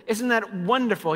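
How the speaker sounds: usual speaking voice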